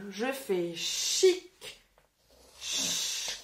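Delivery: chic slow, then ch hissed voiceless alone; slow chic then bare voiceless ch; sounding out chic. Shown as a demonstration. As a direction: isolate the ch sound, breathy and slow. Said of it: "que j'écris".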